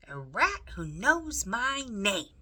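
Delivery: put-on a loud amazed shout from a deaf girl. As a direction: yell it so a half-deaf ear hears. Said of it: "shouted Meg".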